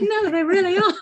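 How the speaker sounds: high, loud retold protest through laughter; retold insistence. Telling her children it's true, laughing insistence. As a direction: insist laughingly, pitched well up.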